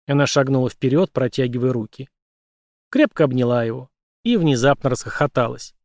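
ordinary delivery, nothing marked

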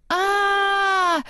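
high, drawn-out "aaah"; mock scream held on one high note; startled scream. A narrator's reading. Anna sees a talking snowman, high startled scream. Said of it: "закричала Анна".

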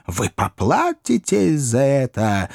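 slow, laboured threat; gasping threat. A narrator's reading. Pickwick winded after the blows, laboured gasping threat. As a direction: threaten as if short of breath. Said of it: "задыхался мистер Пиквик".

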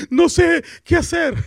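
high and slow, a scared little voice; imitating a scared new leader, high and slow; mock frightened. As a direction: squeak it out, lost and frightened. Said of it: "pone su cara así asustado diciendo".

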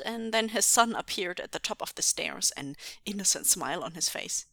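ordinary delivery

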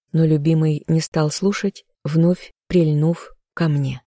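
ordinary delivery, nothing marked

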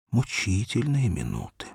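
ordinary delivery

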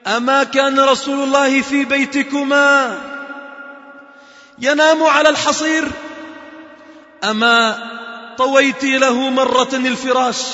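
high, loud and slowing, ending half sung; a grieving reproach that swells into chant; impassioned rebuke. Acted. Umar rebuking Hafsa and Aisha, loud and impassioned, ending chanted.

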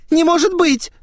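put-on very high, loud girlish protest; squealed disbelief. A narrator's reading. Cornered girl protests to her friend, high squeal. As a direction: shriek it in disbelief. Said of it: "взвизгнула её собеседница".

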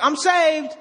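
high mocking "I'm saved"; mock careless believer. Preacher mocking a careless believer, lightly high. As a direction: toss off a smug claim. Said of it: "it's like".

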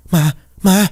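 shrill high scream of terror; terrified scream. A narrator's reading. Stepmother seeing the ghost's portrait, terrified scream. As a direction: shriek as if the ghost appeared. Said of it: "hét lên trong sợ hãi".

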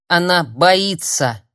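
loud, slow, angry shout; barked. A narrator's reading. Tearful friend protests that healer is afraid, barking. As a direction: bark it out at him. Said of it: "рявкнула Лисана".

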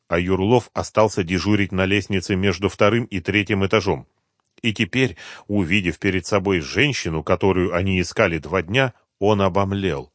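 ordinary delivery

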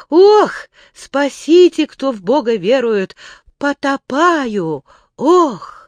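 loud high wail, slow at the end; drowning cry for help. A narrator's reading. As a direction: gasp and cry out while sinking. Drowning man in water.

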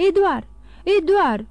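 loud, high call full of unease; anxious shout. A narrator's reading. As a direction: call a missing child in alarm. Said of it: "Rostise numele cu atâta neliniște în glas".

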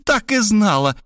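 high, triumphant exclamation; woman gloating as Robert falls in. A narrator's reading. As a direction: gloat at his fall. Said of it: "победоносно воскликнула".

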